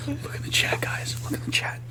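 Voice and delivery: urgent whisper to the group. Real, not acted. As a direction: hush it, just breath. Urgent hushed aside.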